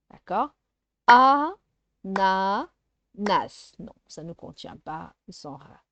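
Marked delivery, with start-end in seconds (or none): 1.1-2.7 "Ananas" loud and slow as a, na, nas; syllable by syllable. Shown as a demonstration. Teacher sounding out syllables for a child, "Ananas" broken up. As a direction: break "Ananas" into three stretched beats. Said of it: "de faire les syllabes".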